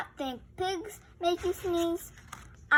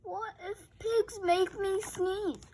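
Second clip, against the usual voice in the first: ordinary delivery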